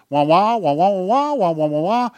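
wah wah mimicking muffled talk, loud and high; mocking wah wah. Preacher mimics words people never hear, loud wah wah wah. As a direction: mouth nonsense sounds like speech you can't make out. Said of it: "you see my lips moving".

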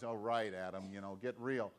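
a hint of God, unimpressed; dry God reply. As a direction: cut through the excuses calmly. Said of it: "And God goes".

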